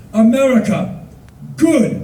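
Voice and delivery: loud, high, emphatic words; hearty toast. Retold or imitated. Bus driver toasts tourist's country, loud and hearty. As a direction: imitate the driver proclaiming approval.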